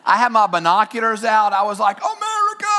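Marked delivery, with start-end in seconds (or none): 2.0-2.7 high, joyful yell of America; screaming. Retold or imitated. Preacher retells cheering the jets overhead, a high joyful scream. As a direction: shout it skyward like a fan. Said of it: "I mean, I was screaming".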